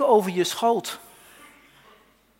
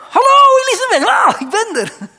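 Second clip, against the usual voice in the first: excited greeting; 14 semitones higher, 12 dB louder than usual. Acted. Mary greets her cousin Elisabeth at the door, loud high excited call. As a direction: call the greeting across the room, high and bright with joy.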